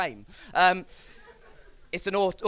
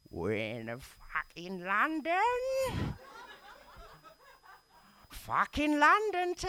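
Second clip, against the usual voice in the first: slow, drawn-out mock Cockney wail climbing high; hammed-up Cockney, stretch each phrase into a high held note; absurdly bad Cockney impression; terrible Cockney; 9 semitones higher, 4 dB quieter, much slower than usual. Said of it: "dial up the Cockney".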